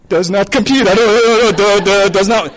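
hammed-up error-message voice; malfunctioning computer. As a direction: stutter like a computer overloading.

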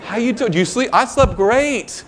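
high, very slow sing-song good morning; gloating judge. Acted. Judge cheerfully greeting the burglar he caught last night. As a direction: drawl it out sweetly, enjoying the moment. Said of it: "He's like".

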